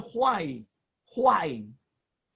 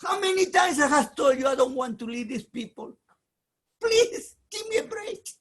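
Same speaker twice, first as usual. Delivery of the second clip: high, loud pleading shout; louder and higher, breaking into a cry; desperate protest; 7 semitones higher, 4 dB louder than usual. Acted. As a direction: shout your complaint at God, near tears. Moshe pleading angrily with God.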